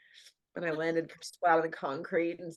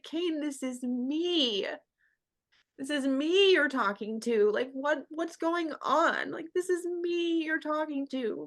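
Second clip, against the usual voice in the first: higher, slow, pleading and bewildered; high pleading disbelief; 8 semitones higher, 3 dB louder than usual. Retold or imitated. Begging her partner to recognize her, high and pleading. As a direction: beg him to see you, voice lifted high. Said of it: "I was like".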